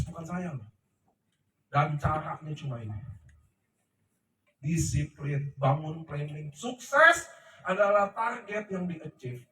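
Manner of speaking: ordinary delivery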